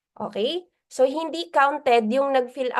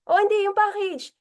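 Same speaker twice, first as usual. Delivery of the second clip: correcting mentor; 9 semitones higher than usual. Retold or imitated. Mentor corrects, acted exchange.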